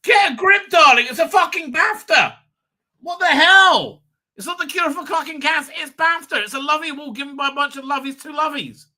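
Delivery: high, loud ranting at the speaker on screen; exasperated outburst. Real, not acted. Mocking an award speech, a high loud exasperated rant. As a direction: yell at the screen, fed up and scornful.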